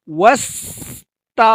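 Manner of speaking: ওয়াস্তাগফির slow, with the গ held, not bounced; correct Arabic pronunciation. Shown as a demonstration. Teacher models ওয়াস্তাগফির for learners, with the গ held. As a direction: recite ওয়াস্তাগফির slowly and hold the গ. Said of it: "আমরা এই ভুলটা".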